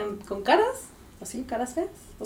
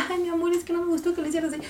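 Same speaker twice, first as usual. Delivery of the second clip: higher, fast sugary scolding; sweet scolding; 6 semitones higher, much faster than usual. Shown as a demonstration. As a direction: scold the dog sweetly, as if praising. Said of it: "la diferencia de fonéticamente".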